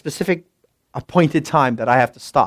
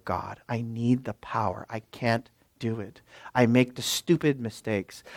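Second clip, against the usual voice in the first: quiet plea; 5 semitones lower, 12 dB quieter than usual. Retold or imitated. Praying plea voiced quietly.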